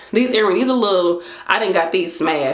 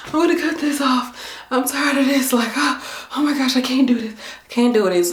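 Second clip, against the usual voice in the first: higher, fed-up whine about her hair; exasperated; 7 semitones higher than usual. Retold or imitated. To herself about cutting her locks, exasperated. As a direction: act out being sick of the locks.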